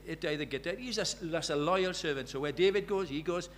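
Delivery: ordinary talk, nothing marked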